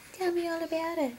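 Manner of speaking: quick soft coo; soft and fast. Real, not acted. Mom coaxing her newborn to babble, soft and quick. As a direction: rush it softly, almost under your breath.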